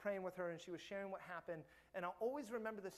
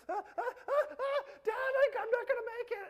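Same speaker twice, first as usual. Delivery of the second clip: put-on high, loud panicky child's wail with a laugh; panicking little girl; 10 dB louder than usual. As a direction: imitate his daughter freaking out in a tree. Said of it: "start freaking out and be like".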